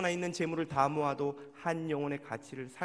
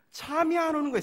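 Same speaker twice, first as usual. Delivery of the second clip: sudden much higher, louder burst; sleepless frustration; 13 semitones higher, 11 dB louder than usual. Retold or imitated. Pastor recalling a sleepless angry night, sharp loud outburst. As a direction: blurt out the exasperation.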